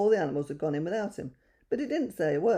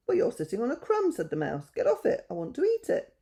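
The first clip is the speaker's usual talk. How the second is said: little mouse voice; 6 semitones higher than usual. Acted.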